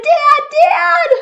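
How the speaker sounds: high loud frantic calling for Dad; scared shout. Acted. Bunny shouting for his dad at night. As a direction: yell for dad in fear. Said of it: "He shouted".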